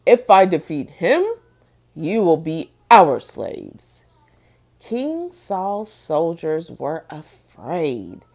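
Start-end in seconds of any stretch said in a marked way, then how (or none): 0.0-4.0 booming giant. Acted. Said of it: "he boomed".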